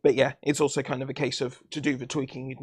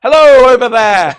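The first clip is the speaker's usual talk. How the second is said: much louder, higher playful call; yelling across the room; 10 semitones higher, 19 dB louder than usual. Pretend. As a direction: call out as if from far away. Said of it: "I don't have to shout across the room".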